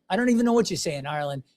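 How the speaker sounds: ordinary delivery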